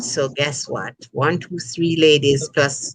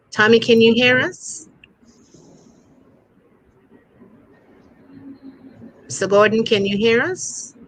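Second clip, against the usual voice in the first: raised pitch, questioning lift at the end; calling out; 5 semitones higher than usual. Real, not acted. Host checking on a remote guest, calling out. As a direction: call out to check someone can hear.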